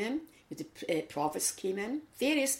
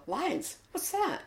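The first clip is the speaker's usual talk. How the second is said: puzzled; 13 semitones higher, much slower than usual. Retold or imitated. Puzzled people asking about Lions.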